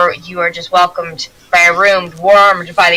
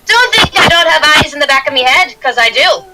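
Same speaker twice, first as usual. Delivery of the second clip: high, forceful dwarven warning; fierce dwarf woman; 8 semitones higher, 3 dB louder than usual. Acted. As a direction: warn the whole gang as a tough dwarf. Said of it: "She's like".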